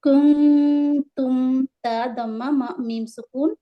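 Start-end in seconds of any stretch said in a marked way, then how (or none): 0.0-1.6 کنتم with the n hidden in a long nasal hum; slow sustained chant of کنتم, n nasalised; ikhfa. Shown as a demonstration. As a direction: hum through the n of کنتم before the t. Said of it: "نون کو اخفا کریں گے".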